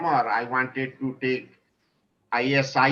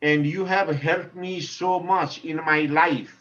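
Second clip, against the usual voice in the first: ordinary talk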